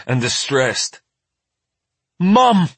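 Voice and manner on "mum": put-on high, loud child's cry for Mum; sharp high call of distress; alarmed cry. A narrator's reading. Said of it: "Maddie cries".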